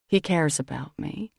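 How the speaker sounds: plain reading voice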